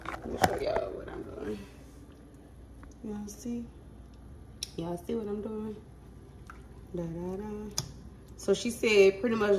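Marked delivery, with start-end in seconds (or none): 0.1-3.7 whispering. Real, not acted. Quiet aside while cooking.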